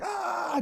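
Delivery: very high drawn-out yell; mock battle cry. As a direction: imitate a spear-thrower's war cry. Imitates Éomer's battle cry throwing spear.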